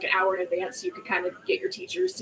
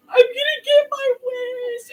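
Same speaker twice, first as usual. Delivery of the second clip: high, slow, tearful, breaking into tears; crying; 5 dB louder, slower than usual. Retold or imitated. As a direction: sob it out as the wish finally sinks in. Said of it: "And I just started crying".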